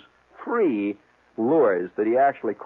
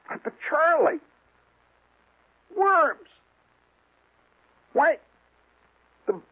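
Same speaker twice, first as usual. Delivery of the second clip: much higher, outraged disbelief at "worms"; incredulous; slower than usual. Retold or imitated. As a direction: squeak out "worms" in mock horror. Disbelief at the worm advice.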